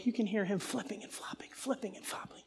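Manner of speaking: hushed storytelling whisper. Retold or imitated. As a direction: whisper as if the boys are asleep nearby. Describing boy tossing at night.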